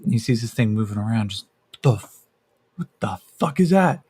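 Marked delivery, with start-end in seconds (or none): 1.8-4.0 startled. Acted. Yakeem startled by a creature.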